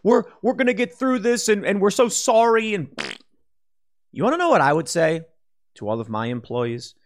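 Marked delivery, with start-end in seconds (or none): 0.0-2.7 mock a CEO grovelling to staff; lightly hammed-up sorry executive; mock corporate apology. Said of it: "they're talking to the employees and like".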